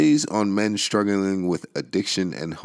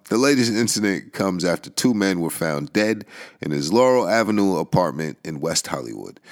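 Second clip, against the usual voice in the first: normal talking voice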